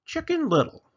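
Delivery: high, loud chicken title. Acted. Chicken announces the story title, high. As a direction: chirp the title out.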